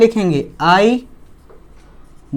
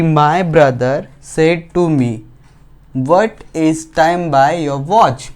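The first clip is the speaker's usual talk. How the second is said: 1 slow dictation; much slower than usual. Shown as a demonstration. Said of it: "अगला क्या है".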